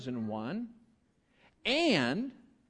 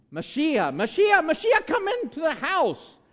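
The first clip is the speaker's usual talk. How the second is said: much higher, loud sing-song call; mom calling kid in; 11 semitones higher, 7 dB louder than usual. Pretend. Pretend mother calling child from the porch, loud high call. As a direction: holler the kid home for dinner. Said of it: "Does that work?".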